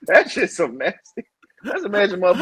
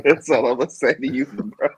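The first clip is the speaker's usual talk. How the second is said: ordinary talk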